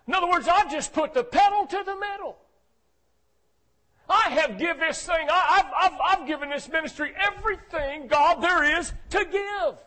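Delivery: high, loud preacher build as Elijah complains; preaching at full force. Acted. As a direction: pour it out, preacher pleading for the prophet.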